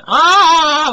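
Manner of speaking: high, loud mock labor groan; a retold groan pitched high and loud. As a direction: imitate a woman groaning in labor. Pregnant woman in labor groans.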